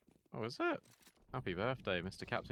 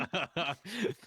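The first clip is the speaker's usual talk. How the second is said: bright laugh; 7 semitones higher, 3 dB louder than usual. Real, not acted. Laughing at her own joke.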